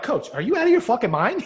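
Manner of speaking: high, loud-ish mock disbelief; incredulous outburst. Players to their crazy coach, incredulous. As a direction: challenge the coach in acted disbelief.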